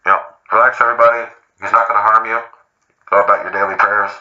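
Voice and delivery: loud commanding cop voice; calm the crowd like a riot officer; hammed-up megaphone officer; mock police announcement.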